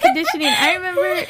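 sudden high excited burst. Real, not acted. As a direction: blurt it out, thrilled.

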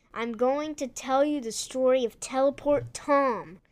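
ordinary delivery, nothing marked

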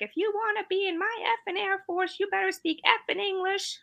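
high, mock barked drill sergeant quote; yelling drill instructor. Recruit mocking the instructor who yelled at her. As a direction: bark it like a Tennessee drill instructor. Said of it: "That voice also".

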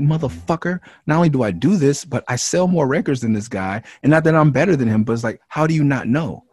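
heated retort. Retold or imitated. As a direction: fire it back loud and high, stung by the question. Retelling his retort to fans.

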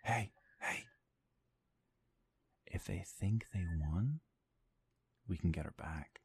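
soft hushed urging; stealthy quiet voice. Acted. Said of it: "a voice that's trying to be stealthy".

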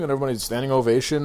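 ordinary talk

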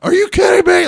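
high, loud, joyful shouting; dad's excited yell. Retold or imitated. Father woken by phone call, shouting with joy. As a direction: burst out yelling in disbelief. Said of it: "he was just like".

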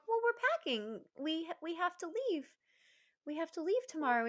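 high, gently explaining parent; patient parent. Retold or imitated. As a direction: explain it kindly to a small child. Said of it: "And we're like".

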